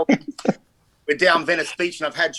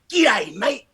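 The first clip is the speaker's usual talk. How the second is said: high, swooping Aussie g'day; mock matey hello, pitched up; hammed-up greeting; 6 semitones higher, slower than usual. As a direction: greet every fan in a put-on high voice. Acting out greeting Lee's fans.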